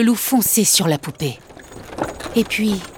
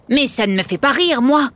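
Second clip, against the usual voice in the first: grumbling puppet; 8 semitones higher than usual. Acted. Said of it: "grognait Niafron".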